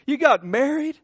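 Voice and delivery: loud, high, delighted disbelief; astonished question. Retold or imitated. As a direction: blurt it back, pitch jumping up. Reacting to caller's news, retold.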